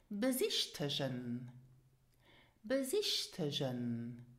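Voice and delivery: "Besichtigen" as buh-ZISH-tuh-zhen; low, careful "Besichtigen" with zh for g; pronunciation example. Shown as a demonstration. Teacher giving examples to repeat, soft-zh "Besichtigen". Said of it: "repeat after me".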